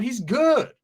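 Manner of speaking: usual speaking voice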